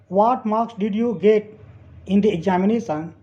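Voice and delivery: slow, split into two parts; slow dictation. Shown as a demonstration. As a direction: read it slowly in chunks for learners. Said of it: "teacher reads sentence slowly".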